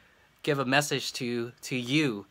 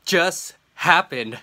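stunned; 6 semitones higher, 10 dB louder, much slower than usual. Real, not acted. Stunned by sudden news.

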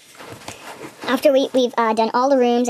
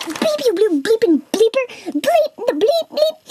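high-pitched tirade of bleeped swearing; imitated cussing match; 11 semitones higher, 4 dB louder, slower than usual. Players cussing at each other, high squeaky mock tirade. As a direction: rant in squeaky censor bleeps. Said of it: "they're all, like, cussing at each other".

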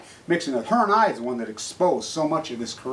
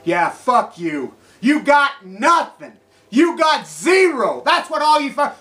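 slow, loud, high furious yelling; furious shout; 8 semitones higher, 7 dB louder, slower than usual. Real, not acted. Ranting vlogger yelling at his viewers, slow and furious. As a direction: spit each word out in rage.

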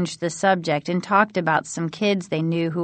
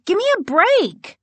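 higher-pitched exasperated protest; exasperated retort; 13 semitones higher, 4 dB louder than usual. Acted. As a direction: protest as if fed up. Acted exasperated retort in dialogue.

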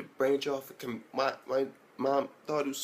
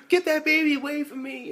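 high hammed-up cry; mock panicked Scar; 4 dB louder than usual. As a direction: push the baby away in fake panic. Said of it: "she's like".

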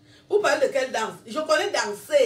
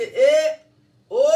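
loud cheer, reliving the triumph; jubilant; 3 semitones higher, 8 dB louder than usual. Retold or imitated. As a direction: call out in jubilation, loudly. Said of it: "je jubilais quand je faisais ça".

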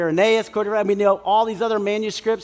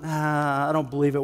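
much lower, softer dismissal; skeptical scoff; 6 semitones lower, 4 dB quieter than usual. Retold or imitated. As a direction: brush it off flatly.

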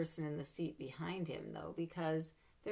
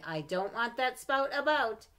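scolding mother; 7 semitones higher, 10 dB louder, slower than usual. Acted. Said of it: "she would tell me".

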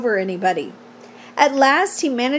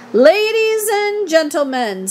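slow, higher, stretched public address; loudspeaker announcer; 5 semitones higher than usual. A narrator's reading. Loudspeaker addresses the fairgoers, grand announcement. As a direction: hail the crowd like a fair announcer. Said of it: "said the loudspeaker".

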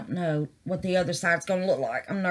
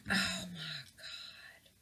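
awed whisper; 13 dB quieter, slower than usual. Real, not acted. Awed by the bright colour.

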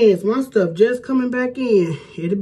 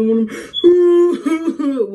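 slow, loud, half-laughing mock boohoo sob; ugly boohoo cry; 7 semitones higher, 5 dB louder, much slower than usual. Mom imitating her own ugly cry, slow mock sobbing. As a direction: play up an ugly cry for a moment. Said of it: "one of them boohoo cries".

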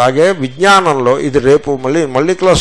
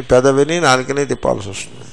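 usual speaking voice

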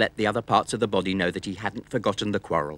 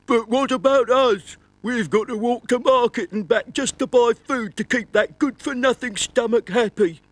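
high grumbling voice; grumbling feet; 5 dB louder than usual. Acted. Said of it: "grumbled the riddler's feet".

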